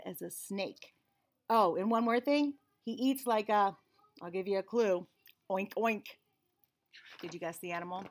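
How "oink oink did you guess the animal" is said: ordinary delivery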